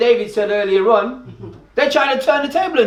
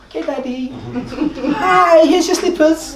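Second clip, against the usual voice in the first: mock sweet daughter; 6 semitones higher than usual. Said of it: "she'll be like".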